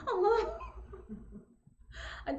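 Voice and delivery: ordinary talk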